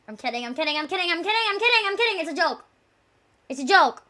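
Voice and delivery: rapid repeats climbing in pitch; hurried backpedaling. Pretend. Streamer hastily taking back a joke, mock-panicked backpedaling. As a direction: rush it out, rising as it goes.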